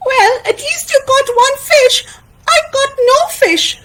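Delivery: put-on high, childlike character voice with a complaint; high-pitched sulky friend; high-voiced Taín, disappointed.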